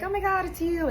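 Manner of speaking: put-on high, thrilled little-kid squeal; excited kid greeting. Preschooler running to their parent, high thrilled squeal. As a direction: mimic a delighted preschooler.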